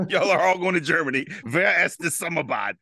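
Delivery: drill sergeant. Retold or imitated. Drill sergeant to recruits in boot camp, imitated announcement. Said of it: "only thing my drill sergeant told me".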